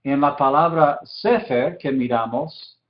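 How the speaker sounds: normal talking voice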